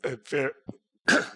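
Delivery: coughing and struggling to get words out; real cough breaking into a slow start; losing voice. Real, not acted. Panelist apologizing to the room, coughing and hoarse. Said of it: "sorry, losing my voice".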